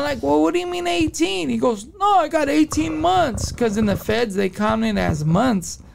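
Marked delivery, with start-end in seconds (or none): 0.2-1.5 high-pitched puzzled question; retold confusion. Prisoner questioning another inmate's sentence, retold confusion. Retelling his own confusion. 2.0-3.5 higher, insistent mock reply; retold correction. Inmate correcting his friend about the sentence, insistent.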